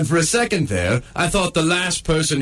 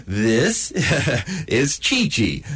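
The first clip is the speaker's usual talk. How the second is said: ordinary talk, nothing marked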